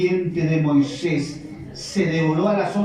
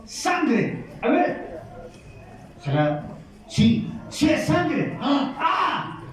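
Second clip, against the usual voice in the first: much higher, startled cries; shocked Egyptians; 9 semitones higher, much slower than usual. Acted. Egyptians discovering the bloody Nile, high shocked cries. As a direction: gasp at the blood.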